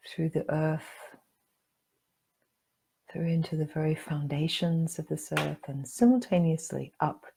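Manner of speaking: calm meditation guide with long pauses; guided meditation. Acted. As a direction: guide them gently inward, leaving room to breathe.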